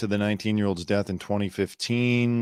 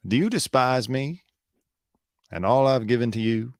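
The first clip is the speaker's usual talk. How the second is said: Southern lawyer voice, higher and slow at first; Alex Murdaugh honeyed southern lawyer drawl; 3 semitones higher than usual. Retold or imitated. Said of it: "I imagine he speaks like a typical Southern lawyer".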